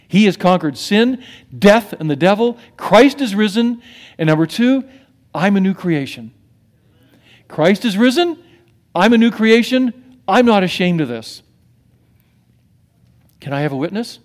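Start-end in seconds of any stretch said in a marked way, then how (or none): 0.1-11.4 fervent sermon cry; preaching proclamation. Acted. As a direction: proclaim it to the crowd. Preaching build-up.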